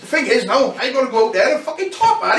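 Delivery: high, loud laughing exasperation; mock-exasperated headliner; K-Man's complaint. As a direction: voice him amused but beaten. K-Man laughing, half-complaining.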